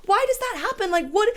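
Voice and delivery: high, bewildered wail; squeaky baffled cry. Real, not acted. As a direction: ask it in tearful disbelief. Exasperated high outburst.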